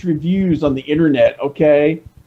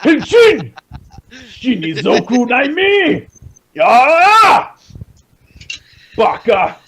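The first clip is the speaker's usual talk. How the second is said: put-on Japanese accent; 7 semitones higher, much slower than usual. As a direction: shout it in a cartoon Japanese accent. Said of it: "my Japanese accent".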